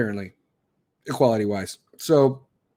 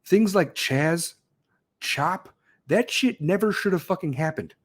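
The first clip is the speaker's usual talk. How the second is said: ordinary delivery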